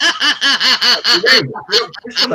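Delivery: high, loud, slow disbelief with a laugh; incredulous. Retold or imitated. Job centre officer hearing the dog excuse, incredulous and laughing. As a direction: drag it out, half laughing in disbelief.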